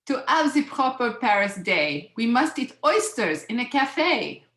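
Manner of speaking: hammed-up French accent, proper and insistent; bad French accent. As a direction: instruct like a mock French hostess. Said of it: "I'm gonna do my bad French accent".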